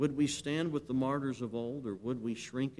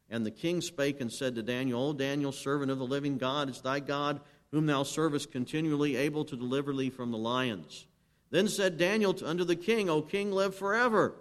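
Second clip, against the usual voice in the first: loud, higher scripture proclamation; full-force preaching; 5 semitones higher, 8 dB louder than usual. Acted. Preacher reading Daniel in the lions' den to the congregation, loud ringing proclamation. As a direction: cry the king's and Daniel's words out to the church.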